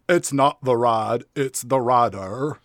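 slow mock Southern drawl; drawn-out, put-on Southern accent; Sean Gump. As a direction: imitate a Southern movie hero. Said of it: "a character that spoke like this".

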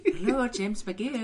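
high, slow falsetto phone greeting; Mrs. Doubtfire voice. Retold or imitated. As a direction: put on a sweet old lady phone voice. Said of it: "in his Mrs. Doubtfire voice".

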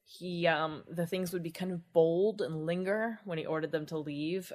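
normal talking voice

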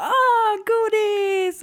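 high, loud gleeful shriek; super-excited squeal. Retold or imitated. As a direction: squeal like a kid handed candy.

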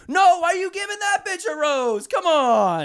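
high-pitched outraged yell at the screen; mock TV yelling. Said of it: "just yelling at the T V".

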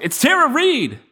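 high, bright reveal of the winner; excited announcement. Pretend. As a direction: announce it like an awards host. Announcing the mock award winner.

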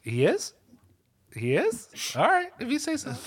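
sarcastic, unconvinced shrug in the voice; skeptical, teasing reply to a fan; mock disbelief. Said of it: "And I was like".

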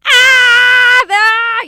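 模仿产妇的高声呻吟; mock labor cries.

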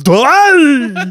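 high, loud laughing yell; hammed-up war cry; mock battle cry. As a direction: scream it at the bats. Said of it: "She just sits up and goes".

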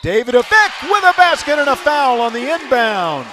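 loud excited play call; big burst of announcer excitement. Real, not acted.

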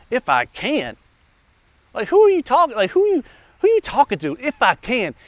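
incredulous. Acted. Jesus answers the doubting father, incredulous. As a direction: throw the words back in mock disbelief.

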